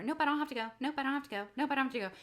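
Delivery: light singsong denial, same tune each time; stubborn daughter. Retold or imitated. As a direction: imitate a child brushing mom off. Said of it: "My daughter, though".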